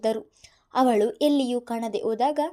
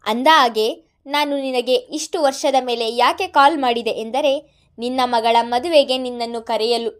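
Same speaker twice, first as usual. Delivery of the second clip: louder, mocking villain on the phone; taunting villain; 8 dB louder than usual. A narrator's reading. Madan taunting Mithun over the phone, loud and mocking. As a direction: needle him with each word.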